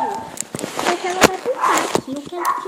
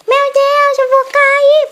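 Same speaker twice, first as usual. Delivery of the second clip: panicked yell, loud and high; scream; 10 semitones higher, 11 dB louder than usual. Real, not acted. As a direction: shout in a scare of falling. Kid falling, screaming.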